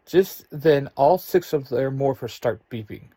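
ordinary delivery, nothing marked

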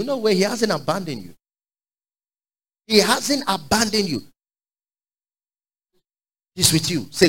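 ordinary delivery

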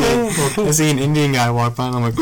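ordinary talk, nothing marked

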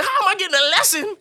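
high, loud mock-outraged question; comic wailing indignation; indignant protest. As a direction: protest the unfairness at full pitch. Indignant protest back to mother.

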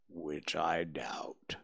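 very low, slow and mournful Eeyore; gloomy donkey. A narrator's reading. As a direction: drag it out like a sad old donkey. Eeyore replies to Pooh.

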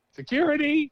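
high mock call for help; calling for security. Mimes calling for club security.

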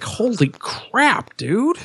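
high, drawn-out mock disbelief; retold shock. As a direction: stretch it out high, as an incredulous reaction. Retelling own shocked reaction to film.

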